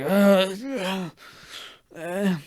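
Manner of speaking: mock stammered groans of discomfort; awkward groans. Guest being introduced to everybody, awkward wordless groaning.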